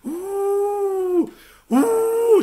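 high, long wolf howl; imitated wolf howl. Storyteller voices wolves in the polar night, high howling. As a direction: howl eerily like distant wolves. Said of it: "lupii urlau înfricoșător".

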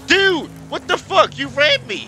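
high, loud real outrage; outraged shouting. Real, not acted. Racer to the one who rammed him, outraged shouting. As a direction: yell at the driver who hit you.

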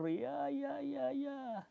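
high, soft drowsy murmur; sleepy murmur. Acted. Gundul dozing on the branch, high sleepy murmur. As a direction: drift off murmuring.

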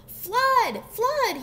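high, loud warning shout; storybook cat raising the alarm; alarmed cry. A narrator's reading. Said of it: "he cried".

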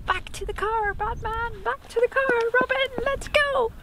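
high, rising heroic rally cry; superhero call. Acted. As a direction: call it out like a campy TV hero. Campy Batman and Robin call-out.

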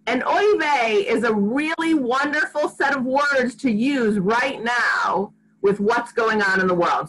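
ordinary delivery

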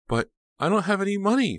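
very high, loud panicky protest; frightened protest. A narrator's reading. Pam answering her attacker, frightened protest. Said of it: "Pam whispered back".

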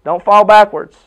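loud, fired-up coach speech; rally the team before the game; halftime pep speech. Acted.

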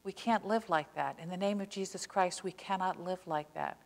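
usual speaking voice

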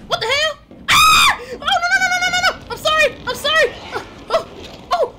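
loud high panicked yelling; being chased. Real, not acted. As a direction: shriek and beg while fleeing.

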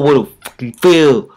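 usual speaking voice

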